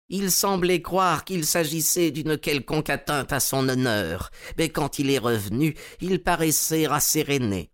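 voix plus aiguë de vieux collectionneur empressé; un collectionneur naïf, un peu plus haut. Acted. (le collectionneur répond à Holmes, voix aiguë et empressée)

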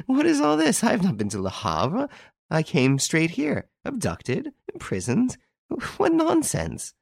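high, incredulous denial opening on a laugh; forced-laugh protest. A narrator's reading. Girl denying to Renine she was abducted, forced laugh. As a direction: laugh it off, pitching the questions high. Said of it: "She protested eagerly with a forced laugh".

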